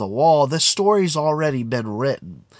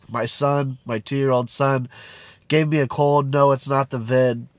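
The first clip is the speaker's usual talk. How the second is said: ordinary talk, nothing marked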